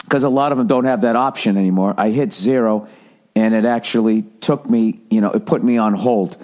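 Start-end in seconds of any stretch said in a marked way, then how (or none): none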